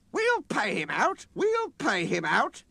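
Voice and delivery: high, loud vengeful mutter; grumbling revenge. A narrator's reading. The coaches vowing to get back at Sir Handel. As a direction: plot revenge slowly and high. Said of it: "grumbled the coaches".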